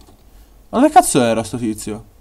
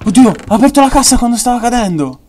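excited; 11 semitones higher, 8 dB louder than usual. Real, not acted. Excited gameplay exclamation.

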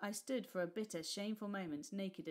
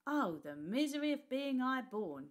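mocking, higher and louder sneer; scoffing; 6 semitones higher, 6 dB louder than usual. Old woman mocking the high-born boy, scoffing. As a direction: scoff at the fine young lord. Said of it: "she scoffed".

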